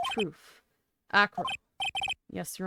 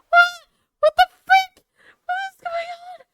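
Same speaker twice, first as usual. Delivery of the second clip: shocked shout; 12 dB louder than usual. Real, not acted. Shocked outburst.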